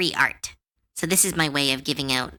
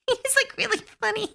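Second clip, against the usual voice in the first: high, fast laughing; faster than usual. Real, not acted. Laughing about the NPC.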